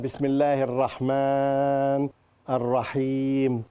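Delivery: "Bismillahir Rahman Ar-Rahim" with long held final vowels; elongated recitation. Shown as a demonstration. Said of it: "So you'd find long".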